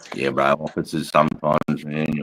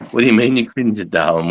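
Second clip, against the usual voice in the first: incredulous; 4 semitones higher, 5 dB louder than usual. Real, not acted. Reacting to a viewer comment.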